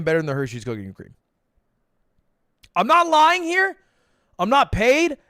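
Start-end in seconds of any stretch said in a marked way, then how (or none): 2.7-5.2 insistent protest. Real, not acted. Insisting he is not paid.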